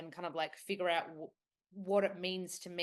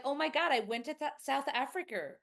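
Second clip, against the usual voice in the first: put-on excited high American accent; imitated American; 8 semitones higher than usual. American client mentioning a trip, high American accent. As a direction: mimic an enthusiastic American, pitched up. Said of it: "And they're like".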